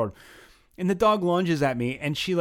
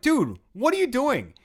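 sharp, high, slow challenge; confronting call-out; 11 semitones higher, 4 dB louder than usual. Retold or imitated. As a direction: call him out, voice jumping up. Confronting a stranger filming him.

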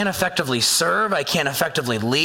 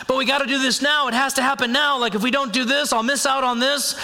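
higher, fast, pressing worry; acted urgency; 7 semitones higher, faster than usual. Preacher voicing someone afraid of missing out, urgent. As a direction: rush it as if time is running out. Acting out impatient urge to act.

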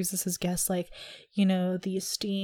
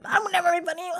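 high mock shout, voice put on; mock friend protest; 9 semitones higher, much faster than usual. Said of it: "she'll be like".

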